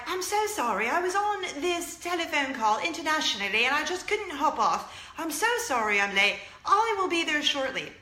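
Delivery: high-pitched, hammed-up British accent; bad British accent. Lady B apologizing to Lady A for lateness, bad British accent. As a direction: do a badly faked British lady. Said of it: "using a bad British accent".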